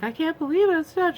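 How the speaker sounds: high, loud, shaken cry of disbelief; upset employee. Retold or imitated. Store employee upset by shoppers, high and near tears. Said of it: "she was really getting upset".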